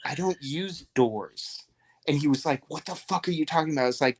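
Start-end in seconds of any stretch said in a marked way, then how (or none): 0.0-1.6 slow, mysterious wizard line; slow mock-mysterious wizard. 2.7-3.8 fast baffled outburst; quick disbelieving inmate; baffled retort. Retold or imitated.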